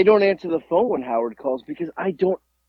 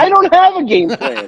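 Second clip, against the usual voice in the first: exclaiming; 7 dB louder than usual. Real, not acted. Laughing denial, high pitch.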